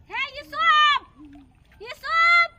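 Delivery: loud high call across the water; calling from far away. Real, not acted. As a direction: shout his name from afar. Calling Yusuf from a distance.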